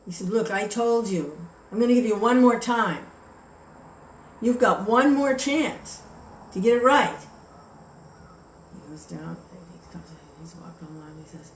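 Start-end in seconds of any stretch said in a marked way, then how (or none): 0.3-7.2 angry warning. Retold or imitated. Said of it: "Now he's really angry".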